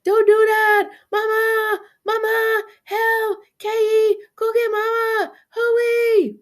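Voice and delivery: high, loud howling with stuck lips; going nuts, wailing high; frantic muffled yelling. A narrator's reading. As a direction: scream for Mama without moving your lips. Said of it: "By went nuts again".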